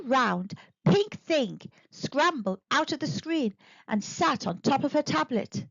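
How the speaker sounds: ordinary delivery, nothing marked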